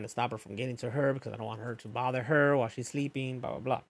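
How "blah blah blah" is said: usual speaking voice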